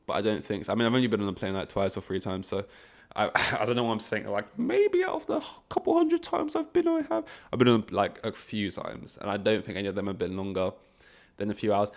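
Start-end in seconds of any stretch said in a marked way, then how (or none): 4.6-7.2 squeaky falsetto, far higher; joking falsetto about hundreds of flights; mock boast. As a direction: pipe it up into a silly high voice. Jokingly inflating his flight count.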